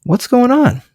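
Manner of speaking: concerned inquisitive check-in; curious tone. Shown as a demonstration. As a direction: gently ask what's wrong, pitch floating up. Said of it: "proper tone of voice to say".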